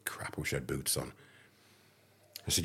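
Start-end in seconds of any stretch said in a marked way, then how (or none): 0.0-1.1 much softer, under the breath; quiet rueful inner grumble; muttered thought. Retold or imitated. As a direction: mutter it to yourself.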